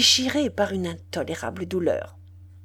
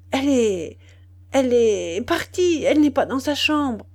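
panicked; 5 semitones higher, 6 dB louder than usual. Acted. Mother reports daughter gone.

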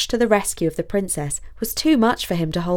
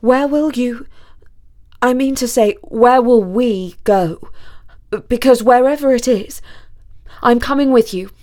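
put-on loud, raised, determined young student; insistent Basil; 4 semitones higher, 7 dB louder than usual. A narrator's reading. Student vows to go with the princess, loud and insistent. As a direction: correct yourself, then insist loudly.

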